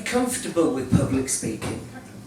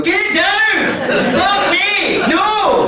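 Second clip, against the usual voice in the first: high, loud mock panic shout; hammed-up Glaswegian panic; panicked yell; 12 semitones higher, 8 dB louder, much slower than usual. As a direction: yell like shots are flying. Glasgow gun panic imitated.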